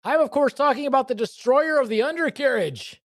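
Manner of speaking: high, grand reveal voice; dramatic announcer. Acted. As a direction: build up the reveal like a showman.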